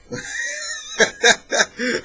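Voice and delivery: high-pitched giggling burst; amused laugh. Real, not acted. Reviewer reacting to a silly spy code name, laughing. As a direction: crack up in high, helpless laughter.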